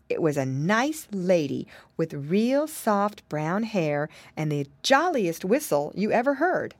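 ordinary delivery, nothing marked

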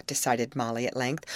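usual speaking voice

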